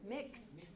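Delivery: soft "Mixed", ending on k-s-t; all consonants pronounced. Shown as a demonstration. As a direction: sound every final consonant of "Mixed". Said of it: "we pronounce them all".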